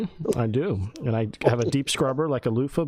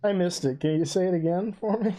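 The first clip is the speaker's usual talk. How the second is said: mock innocent; 8 semitones higher than usual. Teasing mock request.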